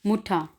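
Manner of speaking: मुठा ending in an open "aa", no R; vowel ending on मुठा; final R as A. Shown as a demonstration. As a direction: say मुठा with the last R turned to "ah". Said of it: "इसको मुठर नहीं पढ़ना".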